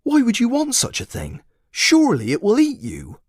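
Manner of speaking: high, doubtful voice; incredulous villager. A narrator's reading. Villager warning the boy, high and doubtful. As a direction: warn him in disbelief. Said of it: "asked another".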